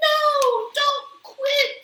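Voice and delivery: high, loud plea; the crowd begging Amelia to stay, loud and high; crowd shout. A narrator's reading. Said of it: "shouted the crowd".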